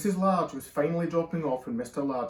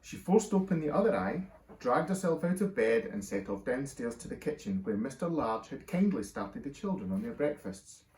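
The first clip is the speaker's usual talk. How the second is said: plain reading voice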